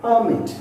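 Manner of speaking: "Permit" with a loud, high first syllable; stress on first syllable. Shown as a demonstration. Teacher showing two-syllable nouns, first-syllable stress. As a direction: hit the start of "Permit". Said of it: "all this word I stressed on the first syllable".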